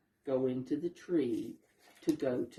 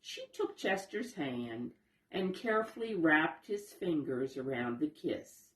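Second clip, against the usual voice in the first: ordinary delivery, nothing marked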